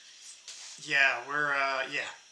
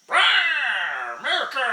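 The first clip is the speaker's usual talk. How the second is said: bird roar; 7 semitones higher, slower than usual. Pretend. Voicing the bird toy.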